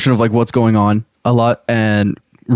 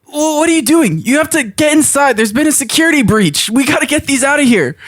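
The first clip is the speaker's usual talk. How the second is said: high-pitched urgent alarm; acted high-voiced panic; panicked warning; 13 semitones higher than usual. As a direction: sound the alarm, voice shooting up high. Acts alarmed warning to guards.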